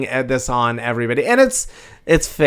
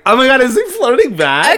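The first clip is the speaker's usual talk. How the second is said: much higher and louder, squealing excitement; giddy disbelief; 13 semitones higher, 8 dB louder than usual. Retold or imitated. Teller squealing over the doctor flirting back. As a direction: gush like you caught a crush flirting. Retelling doctor's wink reply.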